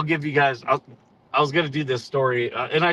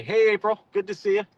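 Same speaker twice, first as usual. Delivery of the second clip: high-pitched, mildly yelled hello; mock yelled greeting; 7 semitones higher than usual. Officer mimicking a captain who yells at his Marines, mock yelling. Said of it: "you yell at your Marines every single day".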